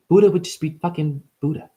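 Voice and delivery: ordinary delivery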